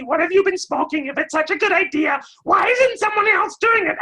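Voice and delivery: very high, loud squawking nag, shouting every objection; squawking seagull critic. Retold or imitated. A coworker squawking at every new idea like a seagull. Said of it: "a giant seagull ... is going".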